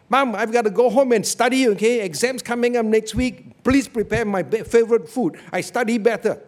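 a bossy son yelling his orders home; demanding son shouting. Retold or imitated. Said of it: "shouted at the mother".

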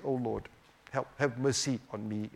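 hushed plea for mercy; humble soft prayer. Shown as a demonstration. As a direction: pray it quietly, bowed down.

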